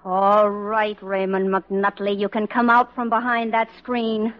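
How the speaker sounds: low, slow reprimand; stern summons. Acted. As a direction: call out a culprit by full name. Orders hidden husband out.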